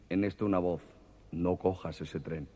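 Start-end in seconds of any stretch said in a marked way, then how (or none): 1.3-2.5 low, slow, softer warning; a mysterious voice warns him off the train; ominous voice. Acted. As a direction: drop the voice like a ghostly warning.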